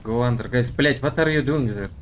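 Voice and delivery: ordinary talk, nothing marked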